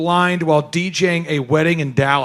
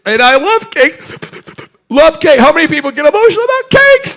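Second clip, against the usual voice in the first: excited; 9 semitones higher, 4 dB louder than usual. Real, not acted. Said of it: "I'm very emotional about it".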